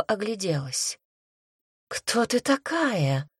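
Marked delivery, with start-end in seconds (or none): 1.9-3.3 slow, higher-pitched question; astonished exhale. A narrator's reading. Succubus confronts the woman who stepped between, astonished. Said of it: "выдохнула женщина".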